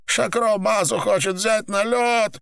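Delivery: high, strained outburst; growled confession. A narrator's reading. Tortured man insists to captors, high strained outburst. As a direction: force it out in a high voice. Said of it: "прорычал Апельсин".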